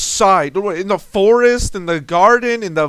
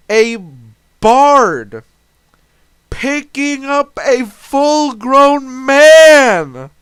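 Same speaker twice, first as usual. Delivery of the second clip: slow, louder, stretched disbelief; incredulous outburst; 4 semitones higher, 6 dB louder, much slower than usual. Real, not acted. Ranting to viewers about the bard, loud incredulous disbelief. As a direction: drag it out like you can't believe it. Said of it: "What the fuck?".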